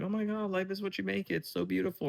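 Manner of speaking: high, soft mock-gushing; super positive. Mimicking people who see all the positivity, mock-gushing. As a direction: gush airily, imitating a relentless optimist. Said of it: "super positive".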